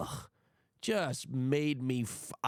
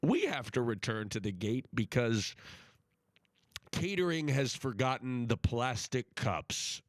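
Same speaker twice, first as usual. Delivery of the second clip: airline captain voice; 5 semitones lower than usual. Retold or imitated. Imitating the captain announcing the plane returns to the gate. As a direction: drone it like a pilot on the intercom. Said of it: "the captain comes over the intercom and he says".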